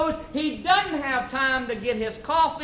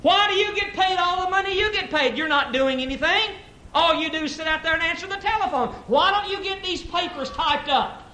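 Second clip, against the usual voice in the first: higher, nagging holler; hollering scold; 7 semitones higher than usual. Acted. Secretary hollering at the receptionist, scolding. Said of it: "She hollers at the receptionist".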